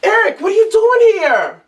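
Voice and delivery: surprised. Acted. Startled woman at door.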